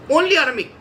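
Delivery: usual speaking voice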